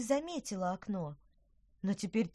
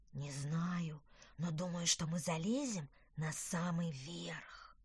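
put-on soft, slow, hushed boy; hushed whisper; 2 semitones lower, 6 dB quieter, slower than usual.

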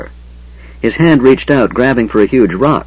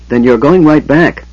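higher, louder angry order; angry yell; 6 semitones higher, 5 dB louder than usual. A narrator's reading. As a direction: snap it out as an angry command. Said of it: "Gilthanas yelled angrily".

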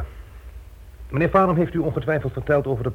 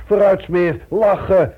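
loud jovial urging; 7 semitones higher, 7 dB louder, slower than usual. Acted. Urging partner to cheer up.

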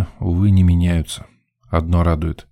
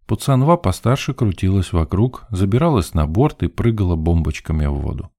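ordinary delivery, nothing marked

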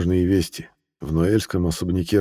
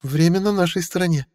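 put-on high, smug old lady; countess voice; 11 semitones higher than usual. A narrator's reading. Mariel answers Philippe.

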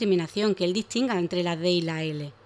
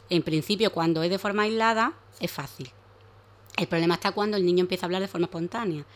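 usual speaking voice

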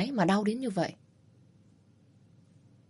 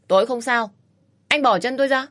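higher, louder panicked protest; alarmed protest; 5 semitones higher, 5 dB louder than usual. Acted. Woman protests as the man grabs her leg, alarmed. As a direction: snap at him to let go of her leg.

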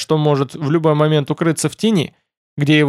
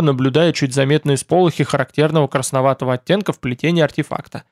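ordinary delivery, nothing marked

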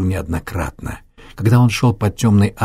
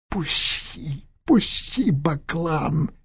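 high, strained gasping plea; wounded man gasping; 7 semitones higher, 4 dB quieter, much slower than usual. Acted. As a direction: gasp it out in agony. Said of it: "выдохнул раненый".

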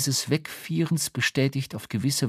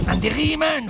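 sharp whisper; 14 semitones higher than usual. A narrator's reading. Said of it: "flüsterte er scharf".

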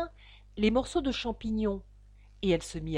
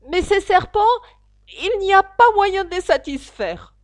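plaintive pigeon; 10 semitones higher, 11 dB louder than usual. Acted. Pigeon complaining past Alice, high plaintive voice. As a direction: list each failure with mounting despair.

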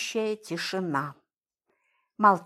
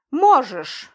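firm; 4 semitones higher, 6 dB louder than usual. A narrator's reading. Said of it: "глядя на него в упор, высказался Кирилл".

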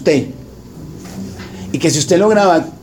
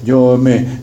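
ordinary delivery

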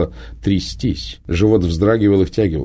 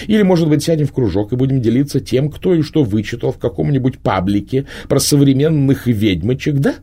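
higher, mocking, scornful witch voice; scornful Prima; 8 semitones higher, 3 dB louder than usual. A narrator's reading. Prima mocks the girl's idea of witchcraft, scornful and high. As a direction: sneer at the girl's naive fantasies. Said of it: "продолжила Прима".

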